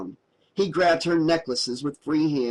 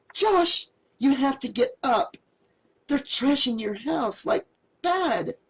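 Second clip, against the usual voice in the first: higher voiced anxious girl pleading; young woman, urgent; 11 semitones higher than usual. A narrator's reading. Mary urging drunk Josh to get up, high and anxious. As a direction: lift into Mary's worried valley-girl voice.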